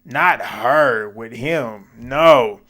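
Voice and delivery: loud no, then a very slow "not her with him"; loud, drawn-out retold protest; emphatic no. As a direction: draw out "not her with him" slowly between two loud noes. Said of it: "I said".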